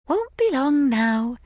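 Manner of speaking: high, slow, creepy sing-song. A narrator's reading. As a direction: lilt it like a nursery rhyme. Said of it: "in a sing-song voice".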